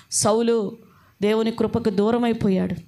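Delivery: ordinary talk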